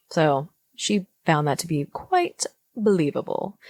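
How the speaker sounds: low, grave documentary narrator; dramatic narration. Acted. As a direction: read it like a solemn documentary.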